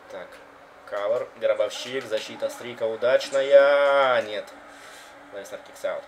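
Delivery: live wrestling play-by-play; wrestling commentator. Acted. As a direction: call the match live. (комментатор рестлинга)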